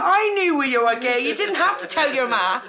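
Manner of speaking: high, loud comic telling-off; imitating a father's outburst; mock exasperated dad. Dad scolding daughter for telling mum, mock exasperated.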